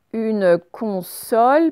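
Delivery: slow, drawn-out dictation; dictating syllable by syllable. Shown as a demonstration. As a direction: stretch the word for pupils to write. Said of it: "dictating word to class".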